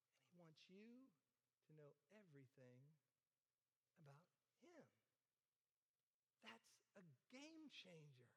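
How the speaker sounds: softly confiding to the congregation; hushed. Acted. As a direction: drop to a near hush. Intimate climax of sermon point.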